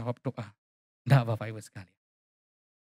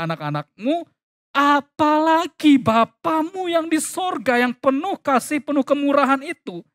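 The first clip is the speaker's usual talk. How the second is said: raised, preaching recitation with pauses; emphatic scripture reading; 8 semitones higher than usual. Acted. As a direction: proclaim the verse phrase by phrase, pitched up.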